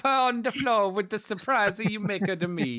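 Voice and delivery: mock Italian accent. Joining the Lamborghini joke with a hammed-up mock Italian accent. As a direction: play a cartoon Italian wailing over his pasta. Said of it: "doing a French accent".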